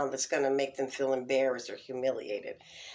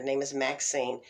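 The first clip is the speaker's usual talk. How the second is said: usual speaking voice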